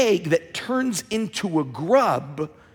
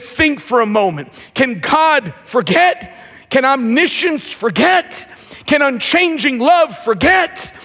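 building to a shout; 4 semitones higher than usual. Acted. Preacher quotes Spurgeon to the church, rising to a shout. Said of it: "Spurgeon said it like this".

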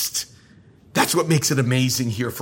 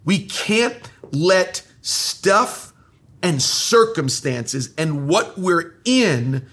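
slow deliberate preaching; slower than usual. Acted. Preacher pleads slowly.

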